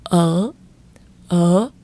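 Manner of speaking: ở dipping down then scooping up; ở dropped then lifted like a question; swing tone. Shown as a demonstration. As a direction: make ở a little U-turn. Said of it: "stay or live".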